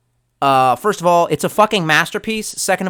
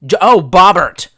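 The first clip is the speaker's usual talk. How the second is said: remembering; 3 semitones higher, 9 dB louder, slower than usual. Real, not acted. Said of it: "What was his fucking name?".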